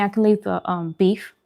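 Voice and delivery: usual speaking voice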